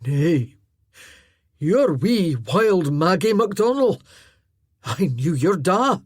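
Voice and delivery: Druston, Scots voice. Acted. Druston recognizing wee Maggie, slow loud Scots voice. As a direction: speak slowly, as an old clansman remembering.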